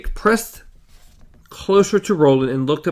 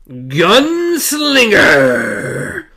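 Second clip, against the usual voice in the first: a huge slow drawn-out boom, louder; 5 semitones higher, 6 dB louder, much slower than usual. A narrator's reading. The hidden machine Blaine booms at Roland, slow and loud. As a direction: call the name out long and loud from everywhere. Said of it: "A voice boomed from everywhere".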